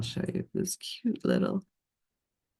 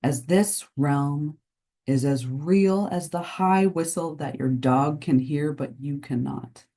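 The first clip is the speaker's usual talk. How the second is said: guided meditation. Acted. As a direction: lull listeners into the unseen realm of nature. Delivers a channeled nature message.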